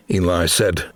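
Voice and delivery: plain reading voice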